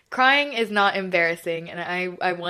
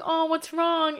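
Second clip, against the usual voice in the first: retold concern in a much higher voice; concerned acquaintance; 10 semitones higher, slower than usual. Acquaintance comes up to someone crying, higher-pitched concern. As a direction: lightly imitate someone checking on you, pitched up. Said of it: "and she was like".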